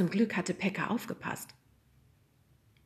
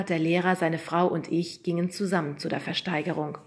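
plain reading voice

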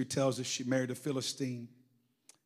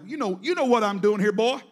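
gruff, louder scolding father; dad scowling at his son, louder and gruff; scowling daddy; 4 semitones higher, 8 dB louder than usual. Retold or imitated. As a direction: scowl it at the boy. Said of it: "with that little daddy scowl on".

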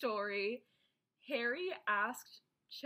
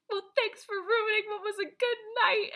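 high, loud tearful wail; hammed-up crying voice; mock crying; 12 semitones higher, 7 dB louder than usual. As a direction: imitate a tearful, wounded outburst. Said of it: "crying on the steps".

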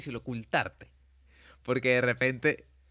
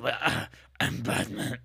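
slow, lightly gravelly Batman line; mock Bale Batman; much slower than usual. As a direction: drag out the famous line.

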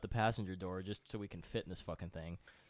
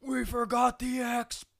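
high, slow, drawn-out wail; mock tragic wail; 13 semitones higher, 8 dB louder, slower than usual. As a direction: play it as a huge tragedy. Brother reveals the trivial reason.

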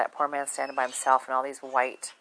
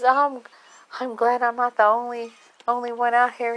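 high, loud put-on elderly voice; imitating an old man confiding in a helper; 9 semitones higher, 6 dB louder than usual.

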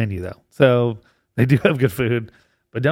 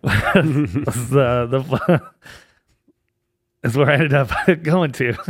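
laughing through the words; helpless chuckling mid-sentence; breaking into laughter; much slower than usual. Real, not acted. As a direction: struggle to get it out through laughter. Reveals the story's punchline, laughing.

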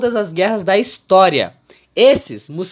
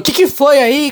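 grito irritado; 9 semitones higher, 8 dB louder than usual. Retold or imitated. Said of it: "O dono dessa casa levantou e falou".

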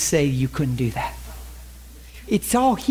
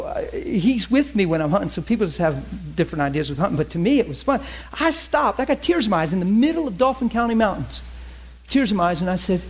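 normal talking voice

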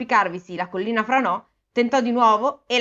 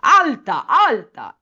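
commanding spell; 4 semitones higher, 6 dB louder, much slower than usual. A narrator's reading. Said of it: "Poi disse".

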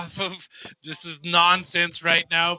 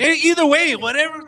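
suddenly much higher and louder, laughing it off; exasperated burst; 10 semitones higher, 9 dB louder than usual. Real, not acted. Cutting off the Bengals pronunciation argument, exasperated and loud. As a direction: throw up your hands over the pronunciation spat. Co-hosts bicker over pronunciation.

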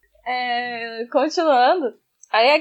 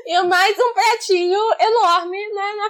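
talking through laughter, higher; laughing; 5 semitones higher, 4 dB louder than usual. Real, not acted. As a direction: laugh while describing. Laughing at hamster image.

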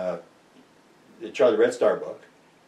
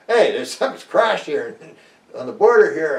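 upset report; 6 semitones higher, 6 dB louder than usual. Retold or imitated. Investigator phoning Ottawa about the crash, upset and raised. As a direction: call it in all worked up.

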